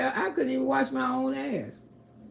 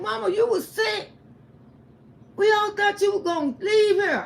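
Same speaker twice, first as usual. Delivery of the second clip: high, louder, half laughing; retold son, pitched up and emotional; 9 semitones higher, 4 dB louder than usual. Son telling mama they thought she'd die, high and laughing. Son recounts her illness.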